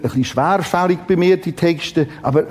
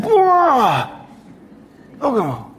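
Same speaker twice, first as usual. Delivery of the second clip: retold wonder; 8 semitones higher, slower than usual. Childhood microscope amazement recalled.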